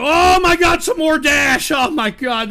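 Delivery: usual speaking voice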